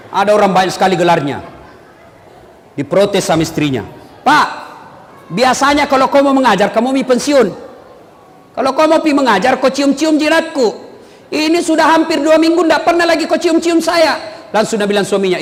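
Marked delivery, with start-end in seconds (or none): 4.3-14.2 rising whiny wife complaint; hammed-up complaining wife; mock nagging wife. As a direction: act out a wife scolding her husband.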